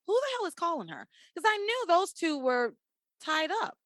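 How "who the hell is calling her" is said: fast, higher exasperated question; baffled, rushing the words. Retold or imitated. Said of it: "I was like".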